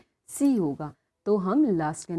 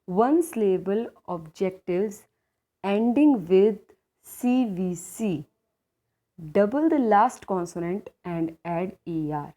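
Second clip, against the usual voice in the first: 1 slow dictation; 4 dB louder, much slower than usual. Shown as a demonstration. Teacher dictates the rule slowly.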